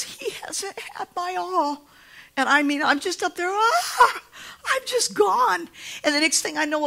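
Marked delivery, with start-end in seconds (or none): 0.0-1.9 high, slow, lightly voiced inner thought; a hint of a wistful confession; high slow quoted thought; voicing his past self. 3.5-5.7 high, loud overwhelmed cry; a mock blissful collapse; overcome. Preacher acting overwhelmed in revival.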